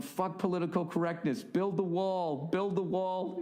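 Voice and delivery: mock rally yell. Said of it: "screaming at all these stupid liberals".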